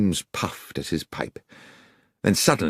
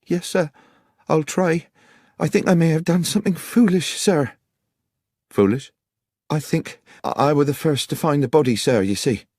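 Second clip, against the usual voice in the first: put-on much higher-pitched young woman's voice; Miss Miller, anxious country witness; 12 semitones higher, 4 dB louder than usual. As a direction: confess uneasily to the detective, pitched high.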